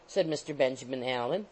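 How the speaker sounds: usual speaking voice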